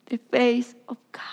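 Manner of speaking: breathy whisper, almost no voice. Acted. Preacher whispering to the congregation, hushed. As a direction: hush it like a secret revelation. Preacher repeats phrase softly.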